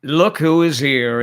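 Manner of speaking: ordinary talk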